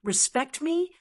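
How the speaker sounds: indignant question jumping up in pitch; high, incredulous-sounding narrated retort; voice rising high. A narrator's reading. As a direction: let the pitch leap on the echoed question. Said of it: "voice immediately rose an octave".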